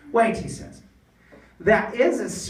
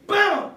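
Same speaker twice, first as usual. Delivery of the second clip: higher, louder boom; mock boom; 11 semitones higher, 6 dB louder than usual. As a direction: punch the boom out like an explosion. Sound effect in story.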